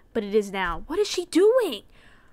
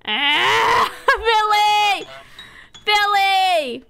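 panicked yelling; shouting; 8 semitones higher, 7 dB louder, slower than usual. Real, not acted. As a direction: scream for help. Screaming as shopkeeper attacks.

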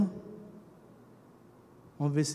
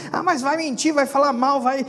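mocking objections; 8 semitones higher, 6 dB louder than usual.

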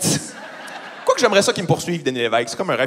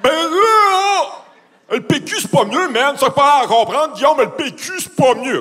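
high, loud dopey voice; dim friend; 9 semitones higher, 3 dB louder, slower than usual. Acted. Friend defending his Liberal vote, dopey high caricature. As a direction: protest like a caricatured dimwit. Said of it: "des amis un petit peu mongols".

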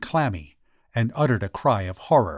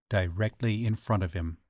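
plain reading voice

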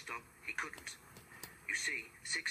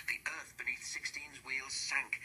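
plain reading voice

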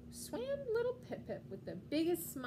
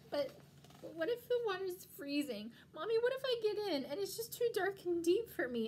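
put-on higher, louder, fretful child voice; anxious little penguin; 5 semitones higher, 4 dB louder than usual. Little penguin frets to mommy about the water, anxious. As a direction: pile up the fears like a worried little one.

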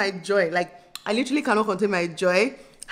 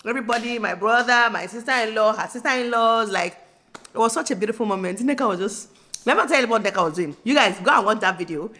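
usual speaking voice

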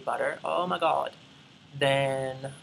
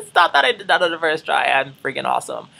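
laughing, proud; 3 semitones higher, 10 dB louder than usual. Real, not acted. Proud after first try.

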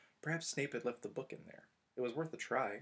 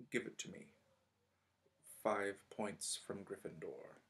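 softer, slow and cutting; almost breathed, menacing; cold hush; 2 semitones lower, 6 dB quieter than usual. Acted. Snape taking points from Gryffindor, soft and slow.

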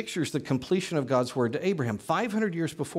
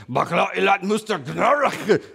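higher mock warlord gibberish; Sumerian warlord imitation; 5 semitones higher, 4 dB louder than usual. Teacher voicing a student's question as a Sumerian warlord, higher hammed-up gibberish. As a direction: bark fake ancient words like a warlord, hammed up. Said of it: "in your Sumerian warlord imitation".

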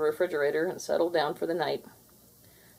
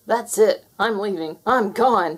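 frightened gasp; 3 semitones higher, 6 dB louder than usual. Acted. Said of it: "he gasped".

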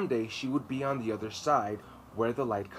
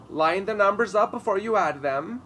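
louder, higher teacher's order; stern teacher; 9 semitones higher, 9 dB louder than usual. A narrator's reading. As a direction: bark the rule at the class. Teacher instructing class.

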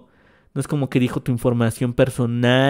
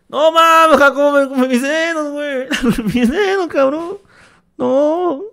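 high, whiny mock complaint; mocking whiny kid voice; 13 semitones higher, 5 dB louder than usual. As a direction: squeal it like a crybaby student. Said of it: "todos en su escuela llegando así". (voz de niño quejumbroso)

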